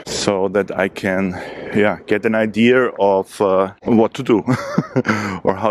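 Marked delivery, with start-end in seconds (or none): 4.5-5.4 brief light laugh; short chuckle. Real, not acted. Laughs at own indecision.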